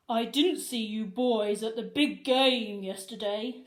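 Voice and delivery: a smug principal laying a trap. Acted. Principal confronting the boys, smug and knowing. Mister Krupp voiced.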